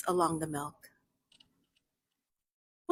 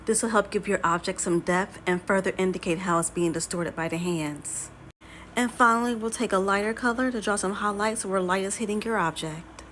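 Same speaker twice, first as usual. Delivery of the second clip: usual speaking voice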